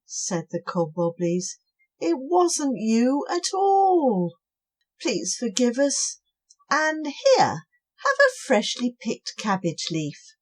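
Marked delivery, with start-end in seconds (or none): 2.0-10.4 gentle apology. Acted. Cobwobblies offering the snail a cabbage leaf. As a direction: make amends gently.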